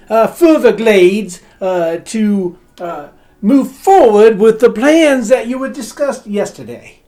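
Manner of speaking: put-on posh accent, over-polite servant; fancy put-on butler accent. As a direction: play a butler faking a posh accent badly. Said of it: "his accent was worse than mine".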